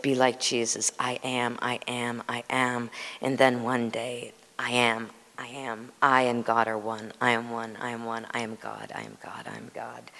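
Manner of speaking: soft, fading mantra to a whisper; hushed repeated meditation chant; meditative mantra. Retold or imitated. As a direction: breathe the words inward like a prayer. Retelling meditation instruction.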